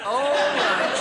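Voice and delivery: wife grudgingly agrees to the horse. Retold or imitated. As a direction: give a sniffy all right. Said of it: "She said".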